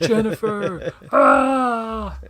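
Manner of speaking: screamed name, pitch flying up, mock anguish; hammed-up anguished cry. Friends goofing on the show, a hammed-up anguished yell. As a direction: overact a desperate cry for a lost love. Hamming up a movie scene.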